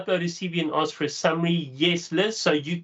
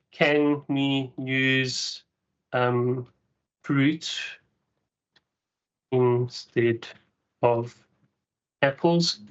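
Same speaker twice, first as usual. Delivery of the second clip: slow dictation; much slower than usual. Shown as a demonstration. Dictating a follow-up prompt.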